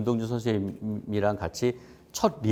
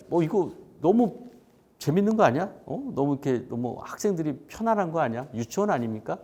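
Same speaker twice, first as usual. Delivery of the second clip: imitating skeptical newcomers, higher pitch; incredulous questioning in a raised voice; 못마땅한 의문; 6 semitones higher than usual. Voicing doubtful academy newcomers. (의아해하며 따지는 말투 흉내)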